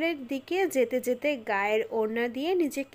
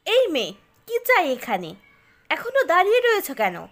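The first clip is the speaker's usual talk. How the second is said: loud, rising angry rebuke; harsh scolding; 5 semitones higher, 7 dB louder than usual. A narrator's reading. Angry boy chasing off a girl with a letter, scolding. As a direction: scold sharply to drive someone away. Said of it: "Ram ধমক দিয়ে বলল".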